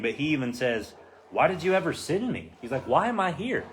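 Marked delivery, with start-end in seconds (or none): 1.3-2.5 exasperated questioning. Acted. Retold Moses questioning God. 2.9-3.6 higher-pitched acted bewilderment; exasperated questioning. Moses asking God why he was sent, exasperated questioning.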